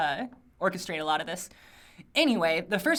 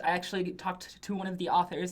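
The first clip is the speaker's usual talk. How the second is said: ordinary talk, nothing marked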